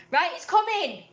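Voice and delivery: real high, loud call. Real, not acted. Answering someone off mic mid-story, calling out loudly. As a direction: shout it through to another room.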